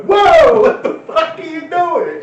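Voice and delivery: high, loud startled shout, slowing on the question; shocked yell. Retold or imitated. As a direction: jump at it, yell in alarm. Retelling his shocked reaction.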